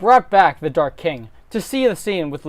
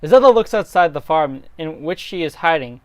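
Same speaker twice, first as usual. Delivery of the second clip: ordinary talk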